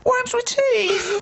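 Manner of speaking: high, slow, hammed-up crying laugh on Worms with teeth; mock big reveal. Friend mocking husband announcing worm fact to wife, high and slow. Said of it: "bring out the big guns".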